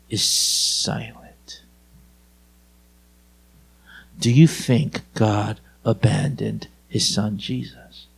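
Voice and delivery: very low, slow and soft; hushed, solemn preaching. Real, not acted. Preacher asks if God abandoned Jesus, hushed and solemn. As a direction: let it sink to a grave hush.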